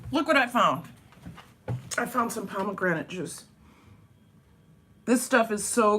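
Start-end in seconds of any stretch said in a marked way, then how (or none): none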